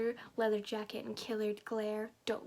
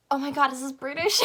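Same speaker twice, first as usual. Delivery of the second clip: much louder, higher, rising disbelief; shocked outburst; 4 semitones higher, 12 dB louder than usual. Real, not acted. Reader finding out her story is British, shocked. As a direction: blurt it out loudly, voice climbing to the end.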